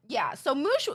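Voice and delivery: ordinary talk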